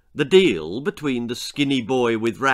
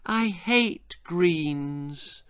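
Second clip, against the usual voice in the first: put-on sulky boy; 5 semitones higher, much slower than usual. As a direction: drag it out like a bored boy. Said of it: "said Bill".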